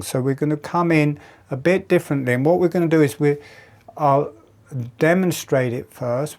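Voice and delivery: ordinary delivery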